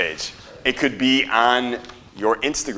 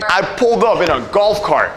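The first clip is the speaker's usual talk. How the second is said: hyped hook; 8 semitones higher, 5 dB louder than usual. Acted. Hook delivered with energy.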